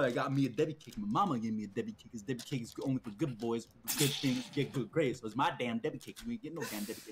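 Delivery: low, quick-talking imitation; Brisbane. Imitating friend guarding his snack cake, lightly voiced possessive friend. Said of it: "I love the way Brisbane talked".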